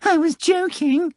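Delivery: high, slow, meek cat voice; a cat backing down sheepishly. Acted. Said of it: "the cat said meekly".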